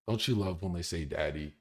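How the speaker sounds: usual speaking voice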